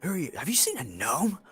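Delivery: fast, high, breathless whisper; wheezing guard. Acted. Gassed guard wheezing his question, fast breathless whisper. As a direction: gasp it out half-blinded. Guard blinded, rasping in gas.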